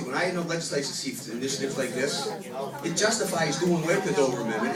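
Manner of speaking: ordinary talk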